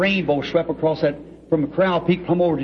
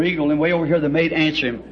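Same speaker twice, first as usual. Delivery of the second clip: ordinary delivery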